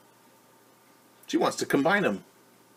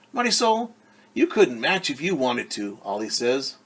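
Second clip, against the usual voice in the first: lively picture-book read-aloud; reading to children; 2 semitones higher, 8 dB louder than usual. A narrator's reading. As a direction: read it brightly to a class of kids.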